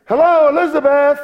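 high, loud bright greeting to a cousin; young girl's joyful hello; voiced greeting. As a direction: call out hello like young Mary. Mary greeting Elizabeth, acted.

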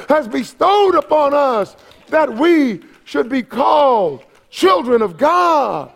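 high, ringing preacher's proclamation; emphatic pulpit crescendo; impassioned preaching. Real, not acted. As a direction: build fervently and cry it out to the congregation. Preaches at full force.

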